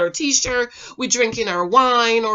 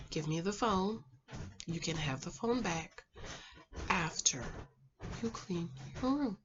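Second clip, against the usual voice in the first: soft monotone; 5 semitones lower, 12 dB quieter than usual. Shown as a demonstration. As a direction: ask a child quietly, with controlled patience. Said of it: "talk in a very soft and monotone voice".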